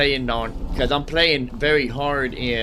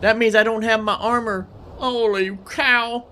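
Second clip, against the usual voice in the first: dismayed surprise; 9 semitones higher than usual. Real, not acted. Streamer realizes he lost his armor, higher and dismayed.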